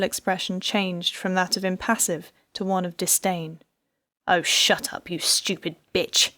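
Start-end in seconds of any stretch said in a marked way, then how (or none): 4.3-6.3 spat contempt. A narrator's reading. Husband snarling at his wife, contemptuous. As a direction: spit the words in disdain. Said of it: "he spat at her".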